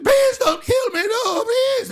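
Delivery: very high, slow wailing plea; mock terrified pleading. Storyteller acts the victim begging the cultists, high mock-terrified wail. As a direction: wail for your life in imitation.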